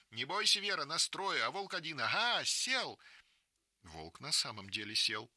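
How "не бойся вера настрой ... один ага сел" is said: excited shout. Acted. Girl shouts over wolf.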